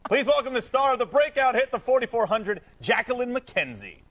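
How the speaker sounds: hammed-up high Australian announcer; bad Australian accent. Host welcoming an Australian guest onto the show, bad Australian accent. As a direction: lay on a terrible fake Australian accent. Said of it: "Was that the worst Australian accent".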